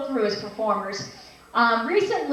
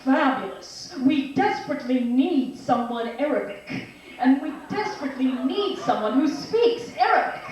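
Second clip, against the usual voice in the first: movie director. Acted. Director to an actor at an audition, theatrical. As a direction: draw out the key words theatrically. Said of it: "the director says to me".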